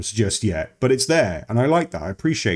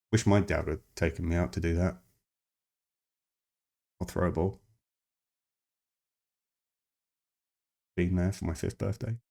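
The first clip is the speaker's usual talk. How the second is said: much softer, a mock-wounded mutter to himself; mock sad aside; 5 semitones lower, 10 dB quieter, faster than usual. Joking to viewers about his own dad, in a softer, mock-wounded voice. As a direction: drop the voice into a deadpan self-pitying aside.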